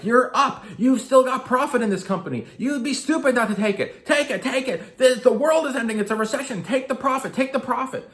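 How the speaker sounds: high, loud, frantic broker yelling at the client; retold broker yelling. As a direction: shout it like a panicked broker pushing you to sell. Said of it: "And he starts yelling".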